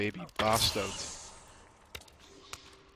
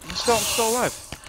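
excited shout; 11 semitones higher, 8 dB louder than usual. Real, not acted. Surviving the attack.

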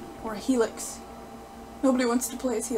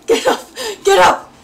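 loud, high commands; urgent yelling; 8 semitones higher, 9 dB louder than usual. Real, not acted. Player urging a fallen fighter, yelling. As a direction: yell the fighter back onto their feet.

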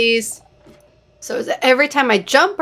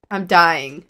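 normal talking voice

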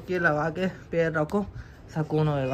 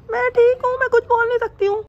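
high falsetto wife voice, a bit louder; mimicking wife; 5 dB louder than usual. Husband mimics his wife to the camera, high falsetto. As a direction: squeak it out like a pouty wife. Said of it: "mimicry कर रहा हूँ मैं".

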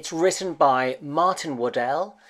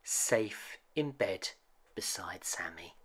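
slow, softer, ending in a whisper; fading to a whisper; 4 semitones lower, 12 dB quieter than usual. A narrator's reading. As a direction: let it drift off to sleep. Bedtime ending, fading to sleep.

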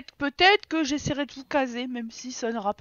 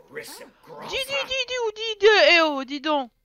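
calling out; 7 semitones higher, 6 dB louder than usual. Real, not acted. Calling a Sim repeatedly.

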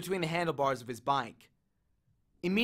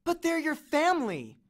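high-pitched, pleading objection; protesting; 11 semitones higher than usual. A narrator's reading. Annabeth objects to Ares setting relatives fighting, high protest. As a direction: protest in a much higher voice. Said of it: "Annabeth protested".

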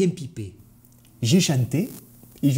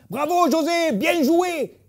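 high-pitched acted cheer; high, enthusiastic shout-out; cheering applause; 13 semitones higher, slower than usual. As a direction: call out bravo like an audience. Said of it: "tout le monde l'applaudit".

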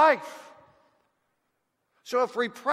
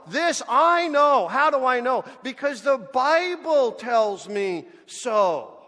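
louder, slow and insistent; emphatic preaching; 4 dB louder than usual. Acted. Preacher declaring to the congregation, slow and loud. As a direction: hammer each phrase home.

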